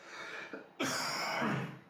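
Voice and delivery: chesty cough from a cold; coughing fit. Real, not acted. Vaper with a cold coughs after a hit, real cough. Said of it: "if you see a coughing fit".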